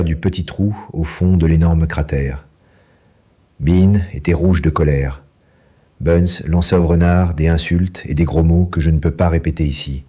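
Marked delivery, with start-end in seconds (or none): none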